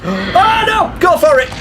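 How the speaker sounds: high, loud panicked yelling; excited yelling. Real, not acted. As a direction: yell in a frantic rush. Being chased, yelling defiance.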